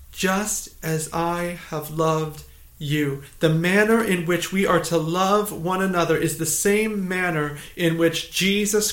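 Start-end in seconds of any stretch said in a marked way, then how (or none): 0.1-3.2 "Just as I have loved you" drawn out slowly; slow, measured emphasis on every word of "Just as I have loved you"; slow, deliberate repetition. Real, not acted. Verse repeated slowly for emphasis.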